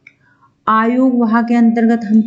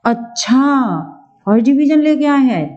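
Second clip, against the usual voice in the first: impressed reaction; 4 semitones higher than usual. Shown as a demonstration. Someone hearing of a first division, impressed surprise. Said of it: "हमारे भावों का उतार चढ़ाव हुआ है".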